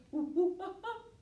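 high hooting monkey laugh; a silly high monkey giggle. Pretend. Teacher to kids, playing the monkey, high giggle.

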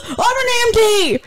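mock yell. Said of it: "there's a guy that yells".

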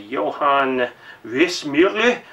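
German pronunciation, slow and careful; German accent. Shown as a demonstration. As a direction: say it like a native German. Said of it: "with my beautiful German accent".

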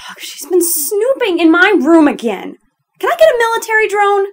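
loud, high-pitched venting; fed-up outburst about a nosy intruder; worked-up, loud complaint; exasperated. Acted. Acted venting about a snoop.

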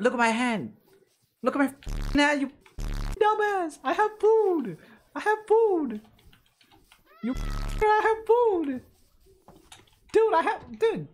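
very high, loud exasperated yell; frustrated yelling. Real, not acted. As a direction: scream at the cat that ignores the food. Yelling at the cat.

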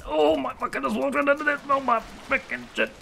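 high, loud panicked swearing; real panic. Real, not acted. Gamer walks into fire, panicked high shrieks. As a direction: shriek as the fire catches you. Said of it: "oh, I'm on fire".

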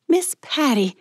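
high-pitched, eager call of greeting; bright forced greeting. Acted. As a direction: call out to her, overly cheerful. Mallory bursts out to greet her.

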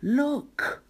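put-on very high child's call; a child pointing something out; children spotting the snail. A narrator's reading. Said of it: "say the children".

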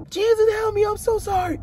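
very high pleading voice; retold desperate cry. Re-enacting his call to Jesus to rescue him, high desperate plea.

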